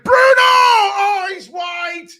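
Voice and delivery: excited shout. Real, not acted. Fan reacting live to Bruno's free header, excited shout. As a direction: yell the name, then groan at the miss.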